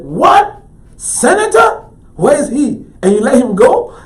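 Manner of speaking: high, loud astonished questions; shocked disbelief. Retold or imitated. Artist to gallery man who let the buyer go, shocked. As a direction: cry out in alarmed surprise.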